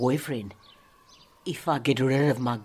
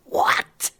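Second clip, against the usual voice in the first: ordinary talk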